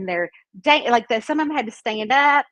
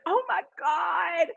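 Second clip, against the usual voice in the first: high, drawn-out astonished exclamation; imitated amazement; 6 semitones higher, 3 dB quieter, slower than usual. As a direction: stretch it like a delighted onlooker. Cackling guys' amazed reaction.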